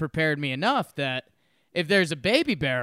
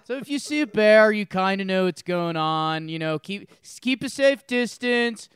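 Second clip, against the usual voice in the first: higher Colorado-California local impression; Colorado California accent; 4 semitones higher than usual. Local advising a hiker about bears, mock Colorado accent. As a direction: imitate a Colorado local giving bear advice. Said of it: "California accent".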